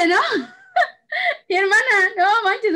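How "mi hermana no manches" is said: high laugh breaking into the words; laughing through it, voice jumping up. Real, not acted. Telling her sister the kids' meltdown, laughing high. Laughing while recounting chaos.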